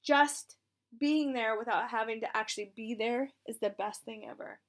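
ordinary talk, nothing marked

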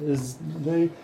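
ordinary delivery

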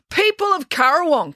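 high, declamatory call; public address. Acted. April addressing the patrons from the table, declamatory. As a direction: hail the crowd grandly.

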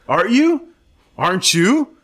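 squeaky high rising disbelief; shocked disbelief. Retold or imitated. Staff member shocked by the patient's confession. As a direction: jump way up in pitch, stunned. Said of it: "I was like".

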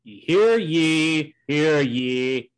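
loud, booming proclamation; town crier. Pretend. Mock proclamation opening the show.

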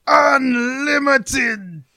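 huge drawn-out high shout; mock villain roar. Fan re-enacting a Sith villain's battle cry, hammed-up slow shout. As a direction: roar it with megalomaniac relish, stretching every syllable.

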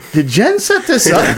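very high, loud disbelief; retold stunned question, pitched high and loud; incredulous. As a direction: act out astonished suspicion, voice shooting up. Retells suspicion of a setup.

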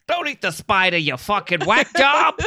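loud, high-pitched New York scolding; New York accent. Acted. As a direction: scold like an exasperated New Yorker. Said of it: "I did New York".

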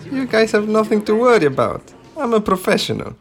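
put-on high, loud heavy Polish accent. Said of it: "heavy Polish accent".

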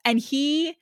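ordinary delivery

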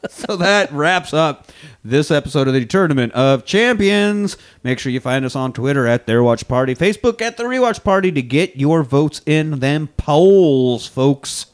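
loud, slow, punchy show outro; announcer sign-off. Acted. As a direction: belt out the closing plug like an announcer.

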